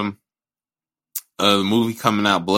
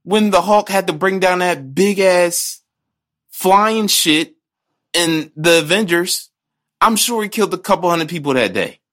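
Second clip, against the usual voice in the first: raised, heated rant; 7 semitones higher than usual. Real, not acted. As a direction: argue it hard and high.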